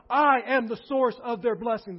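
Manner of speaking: I hit loud at the start; stress on I. Shown as a demonstration. Preacher explaining the source of blessing, loud stress on I. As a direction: punch I, keep the force up. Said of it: "The emphasis is on".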